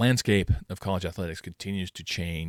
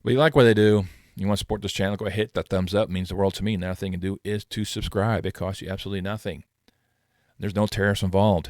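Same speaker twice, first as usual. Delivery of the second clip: louder upbeat outro pitch; outro announcer read; 5 dB louder than usual. Acted. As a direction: sell the subscribe plug brightly. Channel outro call to action.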